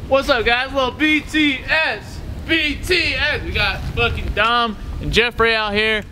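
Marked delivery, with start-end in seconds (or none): none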